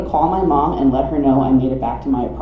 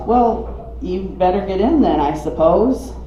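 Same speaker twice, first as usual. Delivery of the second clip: higher, cautious driver's reply; 6 semitones higher, slower than usual. Acted. Driver letting the stranded hiker in, cautious. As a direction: reluctantly offer a lift, pitch raised. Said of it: "Still cautious".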